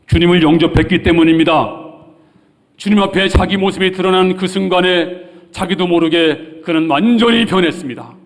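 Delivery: high, loud full-force sermon delivery; urgent preaching. Real, not acted. Preacher to congregation on salvation today, urgent preaching. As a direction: proclaim it urgently at the top of your voice.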